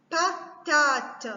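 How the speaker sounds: "Patate" slowly as Pa... ta-te; distinct drawn-out syllables in "Patate"; split into syllables. Shown as a demonstration. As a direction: break "Patate" up for counting. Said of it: "couper les mots en syllabes".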